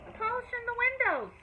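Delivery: calling back. A narrator's reading. Sam calling from porch.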